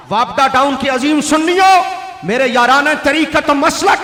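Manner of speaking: high, loud, shouted sermon; preaching at full force. Acted. Preacher shouts his question at the Wapda Town congregation. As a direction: roar it to the congregation.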